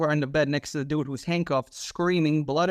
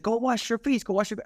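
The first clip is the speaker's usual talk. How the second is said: higher firm instruction; boss instructing; 6 semitones higher than usual. Retold or imitated. Boss sending the bloodied cop off, firm higher order. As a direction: tell him firmly, pitched up.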